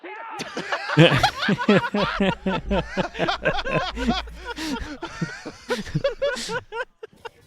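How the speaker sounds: high, loud burst of laughing; loud laughter. Real, not acted. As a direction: crack up hard, high pitched.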